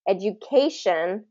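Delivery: "Education" measured, with stress on CA; teacher's example, "Education" as edu-CA-tion; stress before the ending. Shown as a demonstration. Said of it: "stress the syllable right before that ending".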